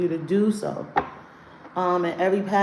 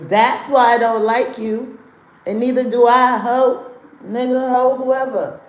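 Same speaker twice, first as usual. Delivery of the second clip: loud diss; 4 semitones higher, 7 dB louder than usual. Real, not acted. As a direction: brush the haters off loudly. Dissing haters in the chat.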